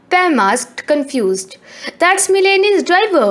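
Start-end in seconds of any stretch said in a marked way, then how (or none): 2.0-3.3 high, louder squeak. A narrator's reading. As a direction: squeak it out in alarm. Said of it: "Colette squeaked".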